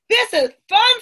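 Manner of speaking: high, loud happy exclamation; excited delight. A narrator's reading. Amma hopping along with her friends, delighted. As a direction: exclaim it with glee. Child enjoys hopping game.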